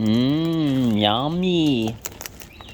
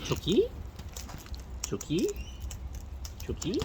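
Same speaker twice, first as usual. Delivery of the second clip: soft coaxing call; 9 semitones higher, 11 dB quieter than usual. Real, not acted. Softly calling shy bird.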